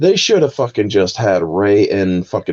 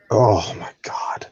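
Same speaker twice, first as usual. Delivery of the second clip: slow, whispery sigh of despair; exasperated groan; 4 dB quieter, slower than usual. Real, not acted. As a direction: breathe it out slowly, worn out. Exasperated sigh at film's plot.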